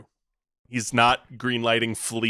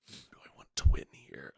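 whispering; 11 semitones lower, 8 dB quieter than usual. Real, not acted. Host mulls winner aloud.

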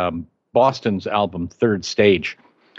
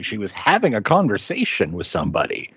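normal talking voice

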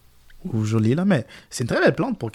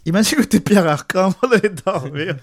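laughing; 8 semitones higher than usual. Real, not acted. Joking suggestion, laughing.

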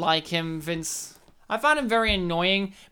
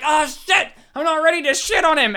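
mock panic; 6 semitones higher, 4 dB louder than usual. Bill panics again.

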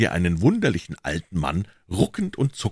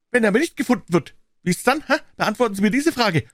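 put-on high, loud, sharp old voice; indignant old man; 5 dB louder, faster than usual. A narrator's reading. Old man confronting Holmes over the papers, high and indignant.

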